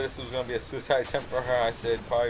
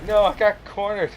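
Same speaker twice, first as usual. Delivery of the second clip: dismayed yell; 9 semitones higher, 9 dB louder than usual. Retold or imitated. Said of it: "And I'm like".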